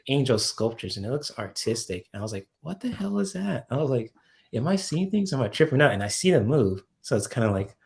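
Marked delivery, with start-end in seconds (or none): 2.6-3.7 mock-startled question to yourself; retold bewilderment. Retelling own startled reaction to visions. 4.5-5.9 higher-pitched questioning of yourself; puzzled, raised-pitch self-talk; retold disbelief. Retelling self-doubt on seeing visions.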